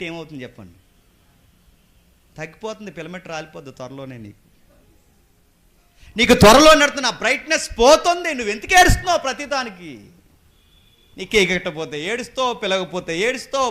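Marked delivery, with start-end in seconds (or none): 6.2-13.7 loud, high preacher's rebuke; full-force preaching. Real, not acted. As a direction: preach it at the congregation, raised and forceful.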